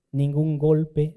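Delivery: ordinary talk, nothing marked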